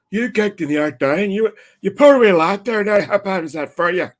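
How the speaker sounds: high-pitched imitation of Northern Irish locals; mock sympathy in broad Northern Irish; Northern Irish accent. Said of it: "the Green side are like".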